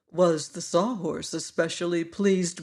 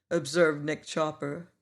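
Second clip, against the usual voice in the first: usual speaking voice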